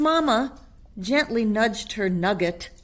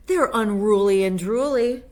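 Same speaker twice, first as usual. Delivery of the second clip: louder, higher, hammed-up disgust; dramatic; 4 semitones higher, 8 dB louder than usual. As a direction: play it up like a stage mother. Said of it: "she added dramatically".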